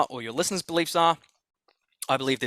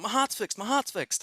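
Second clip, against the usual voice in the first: higher, excited retelling of a healed man's cry; overjoyed exclaiming; 8 semitones higher, 3 dB quieter than usual. Retold or imitated. Healed man shouting to the room, high and elated. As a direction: crow it out, thrilled to be cured.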